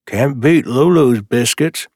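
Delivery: talking through a mouthful; words round a bite of food; mouth full. Acted. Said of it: "with his mouth full".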